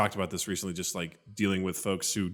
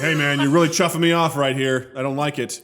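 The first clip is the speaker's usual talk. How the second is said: slightly raised, louder joking complaint; mock annoyance; 3 semitones higher, 6 dB louder than usual. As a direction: play at being put out with someone. Joke: chuffed as a complaint.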